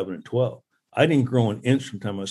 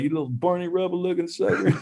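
raised mock ribbing voice; imitating a teammate's teasing jab, pitched up; teasing insult; 7 semitones higher than usual. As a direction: rib a buddy in his voice. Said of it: "Daryl Boston would be like".

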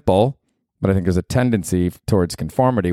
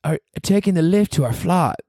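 hammed-up, higher British accent; a badly faked English mum; 10 semitones higher than usual. Playing a British mother with her baby, bad accent. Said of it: "you're terrible at this".

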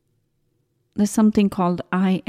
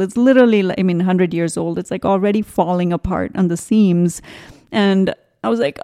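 ordinary delivery

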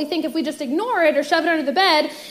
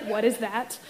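suspicious mom; 7 semitones lower, 8 dB quieter than usual. Retold or imitated. Mom asks about the noise.

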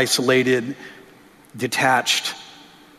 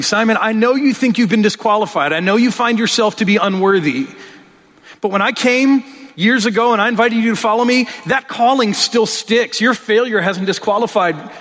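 higher, louder, earnest pleading voice; earnest Jesus; 8 semitones higher, 3 dB louder than usual. Acted. Jesus reassuring Simon he is still called, higher and louder. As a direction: call a broken friend back, urgently. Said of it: "from Jesus saying".

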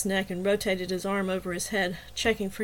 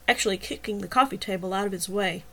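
usual speaking voice

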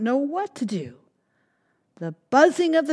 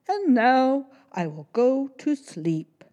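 gentle bear person; 6 semitones higher, 4 dB louder than usual. Acted. Bear going to sleep.

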